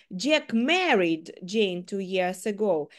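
married lifted and stressed. Shown as a demonstration. Teacher shows stress moving to married. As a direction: lean on the word married. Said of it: "я кожного разу виділяла кожне слово".

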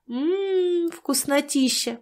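drawn-out delighted mmm; slow relishing praise; savouring. Acted. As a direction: savour it slowly, high and pleased. Dashka savouring the drink.

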